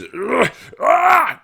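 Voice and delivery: high strained grunting yell; imitated gym yell. Mocking the loud lifter, strained yell. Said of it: "they're yelling".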